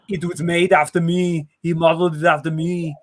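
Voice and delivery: mock Austrian accent; boastful prince in a bad Austrian accent; Arnold Schwarzenegger. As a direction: imitate an Austrian action star. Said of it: "he's like".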